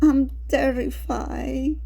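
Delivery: slow, acted trembling fright; trembling fear. Shown as a demonstration. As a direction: draw it out slowly, as if trembling with fear. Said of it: "The voice can tremble".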